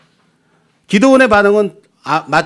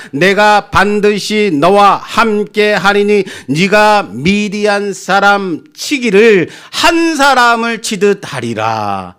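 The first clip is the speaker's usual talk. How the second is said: solemn scripture reading; 4 semitones higher, slower than usual. Acted.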